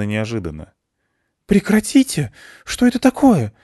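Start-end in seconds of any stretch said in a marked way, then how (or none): 1.5-3.5 high outraged cry; indignant shout. Acted. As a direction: yell in outrage while struggling. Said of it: "возмущённо закричала Ольга".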